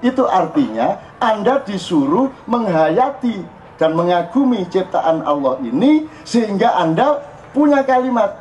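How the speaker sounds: ordinary talk, nothing marked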